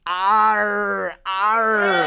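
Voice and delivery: growled arr; pirate arr growl. Acted. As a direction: grunt it like an old sea dog.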